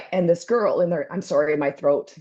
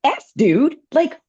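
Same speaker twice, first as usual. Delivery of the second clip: high, outraged what-the; retelling her furious reaction at the stranger; indignant yell; 8 semitones higher, 3 dB louder than usual. Retold or imitated. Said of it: "I'm like".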